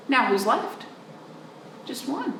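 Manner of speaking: ordinary delivery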